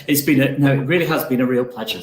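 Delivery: laughing through tears; choking up. Real, not acted. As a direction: voice tight, on the edge of crying. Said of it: "I'm going again".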